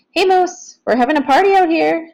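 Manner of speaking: bright shout from the hallway; calling through a door. A narrator's reading. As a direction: call out cheerfully to her brother. Piper calling through bedroom door.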